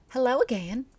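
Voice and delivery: high, bright-sounding cheerful greeting; friendly cook greeting the newcomer. Acted. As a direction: sing it out cheerfully.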